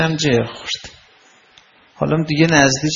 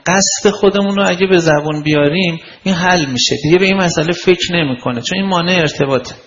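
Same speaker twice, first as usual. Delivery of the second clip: ordinary talk, nothing marked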